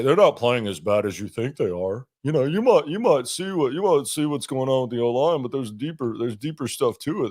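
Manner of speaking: blowhard dum-dum voice, a bit goofy; dopey dum-dum voice. Pretend. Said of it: "It's just the dopey voice".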